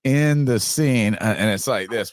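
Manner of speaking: ordinary talk, nothing marked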